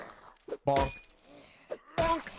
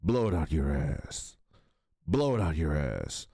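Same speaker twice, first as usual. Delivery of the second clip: much lower tough guy line; deep action hero; 13 semitones lower than usual. Retold or imitated. Quoting the game hero, deep tough voice. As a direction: sneer it like Duke Nukem.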